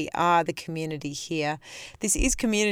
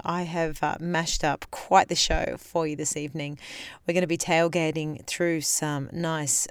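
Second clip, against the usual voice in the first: ordinary talk, nothing marked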